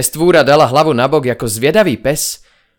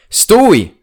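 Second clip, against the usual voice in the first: high, loud order to halt; a sharp, raised command to freeze; 7 semitones higher, 5 dB louder than usual. A narrator's reading. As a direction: bark it at the monster to stop. Narrator commands the monster.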